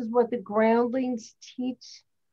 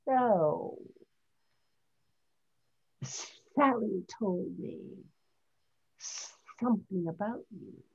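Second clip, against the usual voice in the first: snake voice; 7 dB quieter than usual. Shown as a demonstration. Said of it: "you can use that with".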